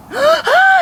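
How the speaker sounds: high sharp scared gasp; frightened gasp. Acted. Girl frightened after call.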